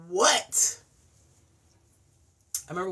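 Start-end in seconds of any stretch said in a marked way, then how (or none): 0.1-0.8 loud startled what; high loud alarmed what. Retold or imitated. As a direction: jump at a noise. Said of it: "I'm like".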